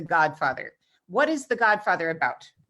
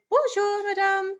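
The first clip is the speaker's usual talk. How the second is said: bonjour, madame sung much higher in a lilting tune; singsong French greeting, bonjour madame way up high; sing-songy; 13 semitones higher, slower than usual. Shown as a demonstration. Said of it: "say it sing-songy".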